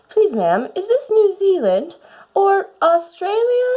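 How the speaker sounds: put-on high, polite little-girl voice asking a stranger; a small curtsy in the voice, very polite. Alice politely asks while curtsying.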